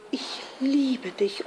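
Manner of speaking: hushed but voiced declaration of love; shy love declaration. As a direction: confess it gently and tenderly. [name] shyly declares love.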